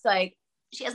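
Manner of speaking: usual speaking voice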